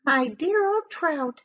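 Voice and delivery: preen like a conceited hag; shrill-voiced boastful aunt; Aunt Sponge's voice. A narrator's reading. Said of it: "Aunt Sponge cried out".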